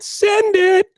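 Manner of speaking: high, loud rider's yell; hyped bike lingo. Retold or imitated. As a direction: shout it like egging a buddy off a jump. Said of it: "that's mo-, mountain bike lingo".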